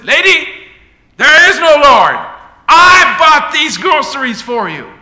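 high, loud, scornful shouting; triumphant angry yell. Acted. Atheist neighbour yells that he bought the groceries, scornful shout. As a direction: hammer home that he paid for it.

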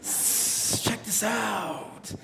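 a sly tempter calling the woman over; smooth-talking snake. Retold or imitated. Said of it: "And the snake says".

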